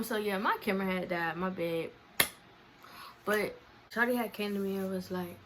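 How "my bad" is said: ordinary talk, nothing marked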